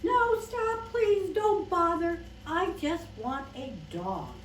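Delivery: acted child at the end of her patience; exasperated plea. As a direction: beg him to stop, fed up. Girl begs him to stop.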